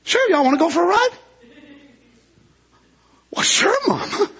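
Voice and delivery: high, quick, eager little voices; little eaglets jumping at the chance. Acted. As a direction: chirp it like excited baby birds.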